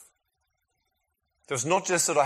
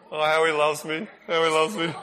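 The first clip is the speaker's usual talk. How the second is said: mock swooning; 2 semitones higher, 7 dB louder than usual. Mocking swooning about Aaron.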